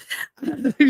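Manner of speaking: high burst of laughter; amused laugh. Real, not acted. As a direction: laugh at your own story. Laughing at her own story.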